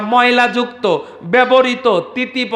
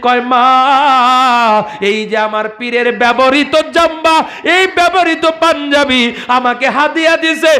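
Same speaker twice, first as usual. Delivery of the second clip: preaching at full force; 10 semitones higher than usual. Acted. Preacher telling a saint's story to the crowd, at full force. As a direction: call it out to the crowd like a village waz.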